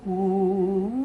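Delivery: high, held "ooh"; "ooh" vowel demo. Shown as a demonstration. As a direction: sing a high, open "ooh". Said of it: "do the ooh also".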